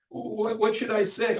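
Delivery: a shy husband at a loss; puzzled husband. Retold or imitated. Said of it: "I said to my husband".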